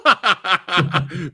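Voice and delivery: bursts into a high, loud giggle; helpless laughing fit; high laugh at own blunder. Real, not acted. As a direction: crack up at the slip. Laughing at own word slip.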